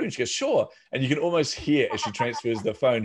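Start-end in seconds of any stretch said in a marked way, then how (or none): 0.3-0.7 mock receptionist, pitched way up; chirpy receptionist. Voicing the receptionist answering his call, pitched way up. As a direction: sing out a bright yes like a receptionist.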